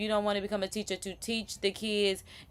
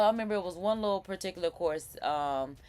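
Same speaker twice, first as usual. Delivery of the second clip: ordinary talk, nothing marked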